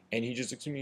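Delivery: ordinary talk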